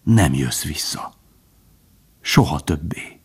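slow, hushed, sad warning; sorrowful. A narrator's reading. Old stag warns Bambi he won't return, sorrowful. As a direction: say it slowly and sadly, nearly whispered. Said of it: "mondta az öreg szomorúan".

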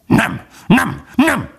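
high, yapping shouted refusal; vicious barking dog. A narrator's reading. Dog refusing the pleading fox, high vicious bark. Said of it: "kiáltott rá a kutya gonosz ugatással".

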